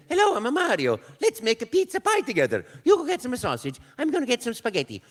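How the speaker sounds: bouncy Italian plumber inviting you to cook; Mario voice. Acted. Actor at his first Mario audition, high bubbly Italian cartoon voice. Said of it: "I said".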